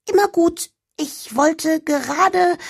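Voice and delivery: high, cheeky little elf. Acted. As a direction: play the lazy elf girl, high. (hohe freche Wichtelstimme)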